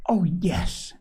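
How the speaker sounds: put-on cheerful yes to a younger child. A narrator's reading. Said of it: "said Polly cheerfully".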